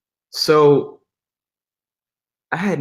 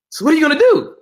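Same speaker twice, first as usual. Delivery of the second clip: high, louder, rising question; imitated eager questioning; 6 dB louder, faster than usual. Pastor pressing him about the job offer, excited questioning. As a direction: press for an answer, voice pitched way up.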